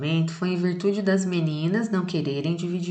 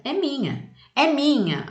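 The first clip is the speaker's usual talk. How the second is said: put-on a touch higher, grabby child shout; girl fighting; 8 semitones higher than usual. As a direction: voice the girl clinging to her doll. Girl's quarrel quote in poem. (menina gritando é minha)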